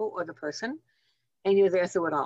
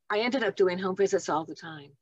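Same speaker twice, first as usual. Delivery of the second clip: normal talking voice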